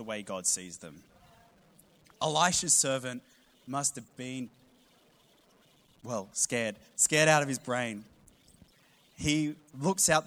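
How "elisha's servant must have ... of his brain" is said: usual speaking voice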